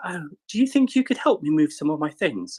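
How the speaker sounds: high, plummy posh English lady; posh Molly impression. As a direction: play a very posh, polite young woman asking a favour. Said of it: "She's like".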